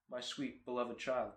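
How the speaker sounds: very soft, hushed mock adoration; mock tender. Car owner doting on his beloved car, mock tender. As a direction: coo softly over a darling child.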